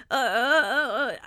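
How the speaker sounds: mock flustered uh uh stammering. Host mocking flustered compliment receivers, stammering. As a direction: fumble for words like you're caught off guard. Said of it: "we're like".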